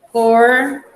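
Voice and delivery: "cor" alone, loud and held; syllable by syllable. Shown as a demonstration. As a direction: dictate "cor" as one separate syllable.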